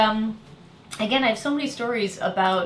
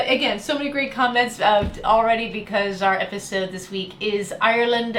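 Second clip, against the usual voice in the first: ordinary delivery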